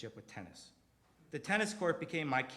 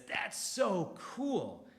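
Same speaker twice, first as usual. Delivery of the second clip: excited; 5 semitones higher, much slower than usual. Retold or imitated. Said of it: "his inflection changed from excitement".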